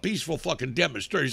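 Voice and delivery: ordinary talk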